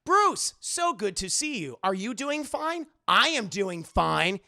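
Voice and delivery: slow, over-enunciated robotic greeting; stilted robot politeness with jumping pitch; robot mayor. Acted. Robot mayor greets Bruce at the elevator, slow and stilted. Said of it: "Like Robo Mayor Hill".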